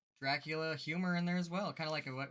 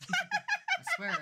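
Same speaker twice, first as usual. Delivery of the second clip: high burst of laughter; laughing squeal; 6 dB louder than usual. Real, not acted. Laughing about the merch.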